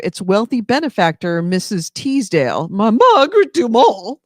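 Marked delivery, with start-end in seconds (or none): 2.7-4.2 put-on grand old-movie dame, high and loud; Margaret Dumont. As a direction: trill the name out like a screen grande dame. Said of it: "impersonation that I can just nail".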